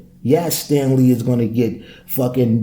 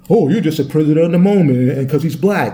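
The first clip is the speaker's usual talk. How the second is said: mock critic; 4 semitones higher than usual. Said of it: "imagined critic's reply".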